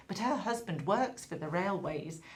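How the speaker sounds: normal talking voice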